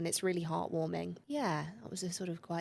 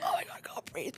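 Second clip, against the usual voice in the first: breathless panic; 4 dB quieter than usual. Retold or imitated. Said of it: "you're like".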